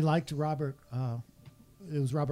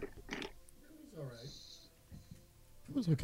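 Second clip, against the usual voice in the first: distant, barely picked up; speaking away from the microphone; far from mic; 3 semitones higher, 12 dB quieter than usual. Real, not acted. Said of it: "I had him on mute".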